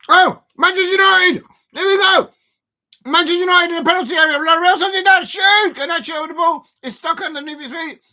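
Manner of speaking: high, loud shouting as the attack builds; excited commentary. Real, not acted. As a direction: urge the shot on at full cry. Live attack on goal, calling out.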